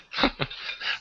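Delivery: soft, breath-only laugh; chuckle. Real, not acted. Host amused at the technical mishap, quiet chuckle. Laughs after mild curse.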